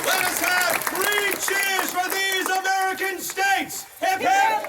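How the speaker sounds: loud, high call to cheer; rally the crowd into three cheers; rousing shout. Acted.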